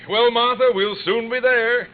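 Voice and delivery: jolly her along on the road; hammed-up hearty reassurance; forced cheer. Cheering up crying wife.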